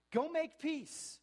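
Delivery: ringing slow shout on make; full-voice shout. Real, not acted. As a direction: throw it to the back pews. Repeated exhortation, climax.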